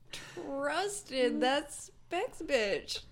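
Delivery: slow mock lament. Betrayed by sales lady.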